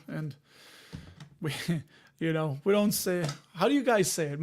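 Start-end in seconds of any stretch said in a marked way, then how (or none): none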